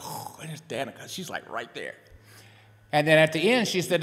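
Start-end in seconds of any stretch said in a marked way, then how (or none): 0.0-2.0 much softer, awed under-the-breath; starstruck hush. Retold or imitated. Seeing Danica Roem on stage, hushed and starstruck. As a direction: murmur it, dazzled by a hero. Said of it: "and I'm like".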